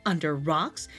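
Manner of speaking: usual speaking voice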